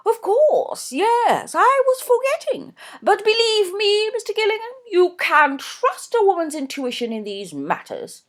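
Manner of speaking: high, loud gushing society mother; gushing Mrs Norbury. A narrator's reading. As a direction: voice her high and effusive, a fussy mother. Narrator voices Mrs Norbury.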